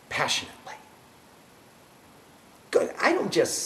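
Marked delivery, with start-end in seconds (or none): 0.1-0.7 breathy whisper of passionately; whispered word. Shown as a demonstration. Preaching about passionate habits.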